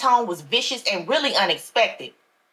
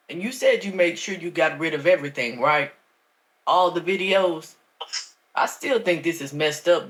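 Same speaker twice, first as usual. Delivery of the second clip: lower, smooth pastor voice; a deeper older preacher talking slyly; Pastor Wilson; 5 semitones lower than usual. A narrator's reading.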